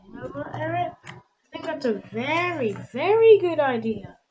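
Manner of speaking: high, loud, glowing approval; delighted praise. A narrator's reading. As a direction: praise the idea with joy. Flora praising Eric.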